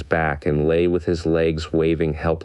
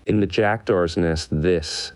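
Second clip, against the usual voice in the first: ordinary delivery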